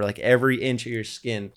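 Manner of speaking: usual speaking voice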